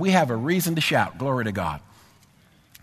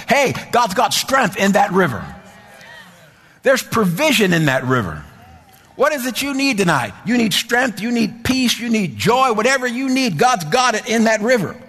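preaching at full force; 6 semitones higher than usual. Acted. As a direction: lift the room with each line. Preaching refrain raised again.

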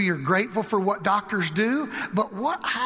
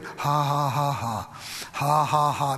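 low, lifeless ha-ha; half-hearted, monotone laugh; forced laugh; 5 semitones lower, slower than usual. Retold or imitated. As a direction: imitate trying and failing to laugh. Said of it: "Man, it wasn't working much".

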